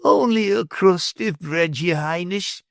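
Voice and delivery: high, slow, lightly voiced beggar pleading; old beggar begging the prince for a crust. A narrator's reading. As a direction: beg humbly for bread. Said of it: "he pleaded".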